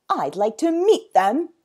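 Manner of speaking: loud protective anger; angry zookeeper. A narrator's reading. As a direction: say it with rising anger. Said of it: "a look of anger flashing".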